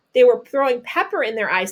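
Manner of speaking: normal talking voice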